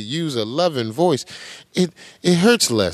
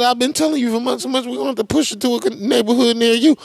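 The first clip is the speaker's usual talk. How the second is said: higher, fast sing-song mimicry of the mayor; mock mayor; 9 semitones higher, much faster than usual. Host parodying the mayor's warning, high rushed mockery. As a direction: rattle off his words, mocking him. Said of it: "He said".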